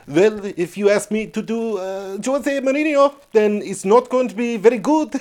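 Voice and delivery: put-on generic Mediterranean accent, poor Portuguese; Portuguese Mister Bean. Answering a listener's request, shaky Portuguese accent. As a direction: do a rough Portuguese-accented bumbler. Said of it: "Do a Portuguese Mister Bean".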